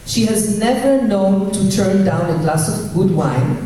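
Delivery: ordinary talk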